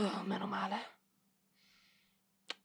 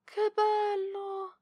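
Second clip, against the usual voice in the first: much higher and drawn out, bursting with delight; overjoyed cry; 10 semitones higher, much slower than usual. Acted. Librarian opening the boy's wrapped book gift, delighted cry. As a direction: gush over the present.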